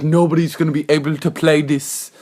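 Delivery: put-on made-up African-Indian accent. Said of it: "I don't know why I came up with that accent".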